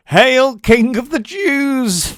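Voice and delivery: very high, loud, sneering jeer; slow, loud mock salute; mocking soldiers. A narrator's reading. Soldiers jeering at the beaten prisoner, loud mockery. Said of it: "they mocked".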